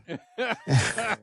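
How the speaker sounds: high bright burst of laughter; real amused laugh. Real, not acted. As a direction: laugh at the memory. Laughing at the daughter's contract.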